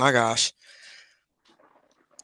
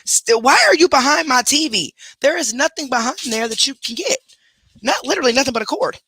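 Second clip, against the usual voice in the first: much higher, exasperated scolding; scolding a pet; 9 semitones higher than usual. Real, not acted. To the pet behind her TV, high exasperated scolding. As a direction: call out the pet for sneaking behind the TV.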